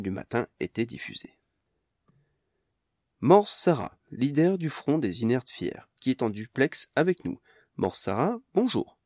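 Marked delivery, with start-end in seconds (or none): 3.2-9.0 news anchor. Acted. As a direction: announce it like the morning news.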